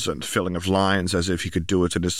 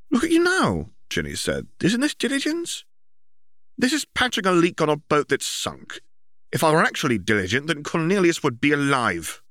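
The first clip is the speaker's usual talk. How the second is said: put-on high bright girl voice, much higher; young girl voice; 9 semitones higher than usual. A narrator's reading. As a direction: voice Ginny as a teenage girl. Ginny's voice in story.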